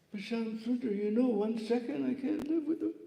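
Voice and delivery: a touch of tearful pleading; pleading Radharani. Retold or imitated. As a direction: beg him, close to tears. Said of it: "Radharani began to cry".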